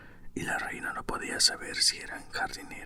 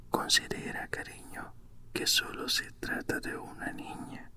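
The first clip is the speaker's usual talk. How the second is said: ordinary delivery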